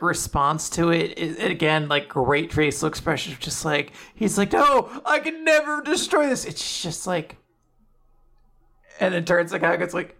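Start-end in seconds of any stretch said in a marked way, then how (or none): 4.5-6.4 high-pitched dramatic refusal; mock anguish. Golfer unable to ruin the treasured glove, mock anguish. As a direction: wail it as a melodramatic vow. Acting horror at ruining precious glove.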